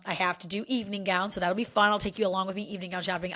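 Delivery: usual speaking voice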